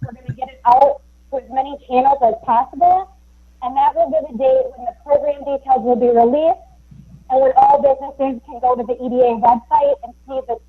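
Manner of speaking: cutting in and out. Real, not acted. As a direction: voice dropping out on a bad connection. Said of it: "your voice is cutting in and out".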